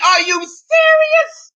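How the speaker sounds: high incredulous wail; squealed disbelief. Real, not acted. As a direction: shriek it out like you can't believe it. Catchphrase squealed much higher.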